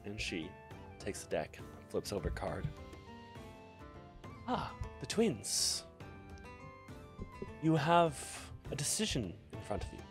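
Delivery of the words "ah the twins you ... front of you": mysterious, teasing questions; fortune teller. Acted. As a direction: draw them into the reading. Fortune teller reading cards.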